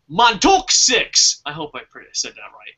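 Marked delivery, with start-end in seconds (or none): 0.1-1.4 "Montauk Six" read very slowly, syllable by syllable; careful slow reading. Shown as a demonstration. Host reading a viewer's name, "Montauk Six" drawn out slowly. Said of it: "I'm gonna mispronounce this".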